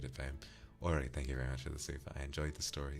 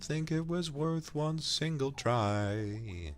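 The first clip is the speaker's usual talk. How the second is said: dramatic high rant; 10 semitones higher, 6 dB louder, slower than usual. Acted. Exaggerated lament then rhyme.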